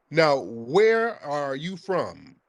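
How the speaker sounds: very slow, word-by-word questioning; slow, emphatic interrogation; deliberate demand. Real, not acted. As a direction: space out each word to press for an answer. Host presses caller for real origin.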